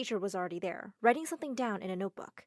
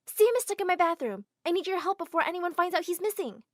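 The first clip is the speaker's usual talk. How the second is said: higher, louder fake alarm; urgent panic; 7 semitones higher, 5 dB louder than usual. Girl faking alarm to the assistant. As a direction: sell the lie with panic.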